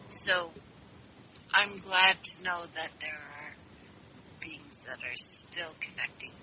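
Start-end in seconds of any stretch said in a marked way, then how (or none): 4.4-6.3 soft unvoiced whisper. Real, not acted. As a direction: breathe it out as a secret. Caller drops to hushed aside.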